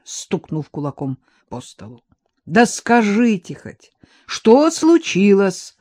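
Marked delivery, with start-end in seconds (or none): 2.5-5.8 loud question. A narrator's reading. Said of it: "громко спросил Виктор".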